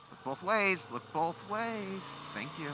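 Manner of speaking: high, loud sarcastic warning; sarcastic scolding. Real, not acted. Scolding a pedestrian.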